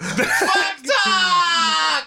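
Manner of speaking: shouted title. Acted. Host announcing the segment to listeners, shouted. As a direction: call out the show segment like an announcer.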